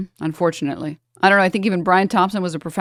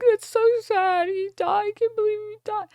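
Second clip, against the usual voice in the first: mock crying mourner. Mocking people who mourn the CEO, high whiny fake grief. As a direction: ham up a sobbing sympathizer, mocking them. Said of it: "sit here and cry and be like".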